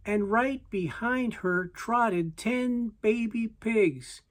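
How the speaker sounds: ordinary delivery, nothing marked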